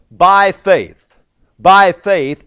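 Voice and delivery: "by faith" said loud and emphatic; stress on "by faith". Shown as a demonstration. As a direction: punch "by faith" each time. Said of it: "Over and over".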